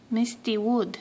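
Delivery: ordinary delivery, nothing marked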